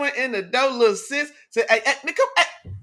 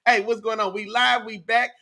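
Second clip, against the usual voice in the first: normal talking voice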